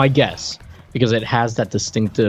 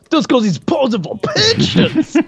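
much higher, slow goofy jock voice; imitating Mad Dog; 3 dB louder than usual. Mad Dog mocking Fingers over the shot clock. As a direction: ham up a cocky meathead. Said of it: "and he's like".

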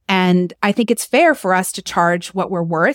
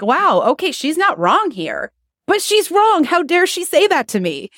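mock outrage; 8 semitones higher than usual. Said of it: "be like".

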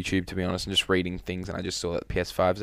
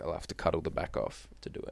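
whispered aside, no voice at all; 4 semitones lower, 11 dB quieter than usual. Real, not acted. To friends about cutting his hair, whispered. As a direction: breathe it out as a secret whisper.

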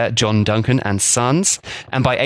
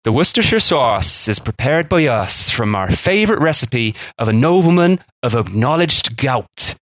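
a rough go at a Worcestershire accent; half-hearted Worcestershire accent on an old advert; Worcestershire accent attempt. Shown as a demonstration. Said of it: "I can't really do a Worcestershire accent properly".

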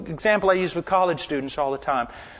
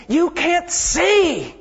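rebuking students; 8 semitones higher, 6 dB louder than usual. Retold or imitated. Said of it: "I look at them and I say".

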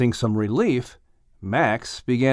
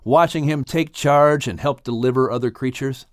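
ordinary delivery

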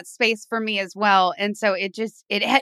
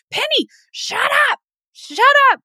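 much higher shrill yell at the cat; shrieking at the cat; 4 dB louder than usual. Retold or imitated. Yelling at her cat Penny during recording, shrieking. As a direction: shriek at your pet to shut up. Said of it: "screaming at my cat".

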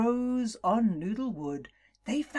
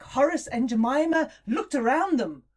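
ordinary delivery

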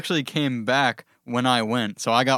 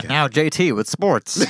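announcer voice; 4 semitones higher, 3 dB louder than usual. Acted. Announcing segment handoff.

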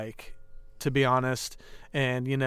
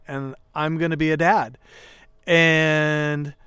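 usual speaking voice

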